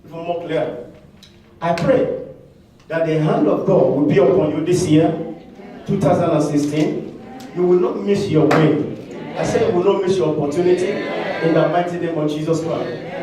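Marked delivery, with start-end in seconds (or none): 1.6-13.2 preacher's declaring prayer; prayer declaration. Acted. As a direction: proclaim it like a blessing. Prayer declaration over congregation.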